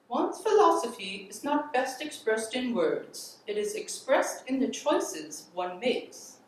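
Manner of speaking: put-on high, loud, swooping old-fashioned patrician American accent; high-pitched formal oratory in an imitated accent; Eleanor Roosevelt accent. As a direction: give it a grand, measured public-address accent, pitched well up. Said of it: "do it in my best Eleanor Roosevelt, um, accent".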